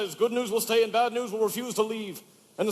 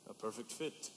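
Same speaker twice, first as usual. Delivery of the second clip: low, very soft parroting; dry echo; 9 semitones lower, 16 dB quieter than usual. Acted. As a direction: echo the tailor dryly. Father dryly echoes tailor.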